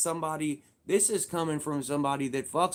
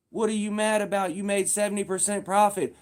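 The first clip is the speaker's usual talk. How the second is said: louder and higher, demanding; heated challenge; 6 semitones higher, 6 dB louder than usual. Real, not acted. Ranting at the complainers, loud and heated. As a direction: fire the question at them.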